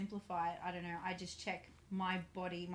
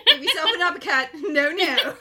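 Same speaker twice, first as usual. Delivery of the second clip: laughing; 9 semitones higher, 20 dB louder than usual. Real, not acted. Laughing loud joke.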